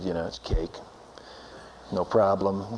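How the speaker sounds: low, soft, casual brush-off; cocky nonchalance. Retold or imitated. Rafter boasting before the rapids, cocky nonchalance. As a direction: play it cool and unimpressed, voice dropped low.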